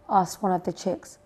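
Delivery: plain reading voice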